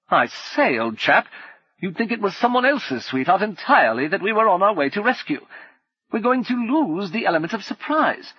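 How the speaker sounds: high, hearty, teasing officer voice; impatient gentleman adventurer. Acted. Brummond impatient at the ladder.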